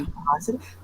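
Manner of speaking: low, hushed imitation of a stalker; weird creepy voice. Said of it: "creepy voice".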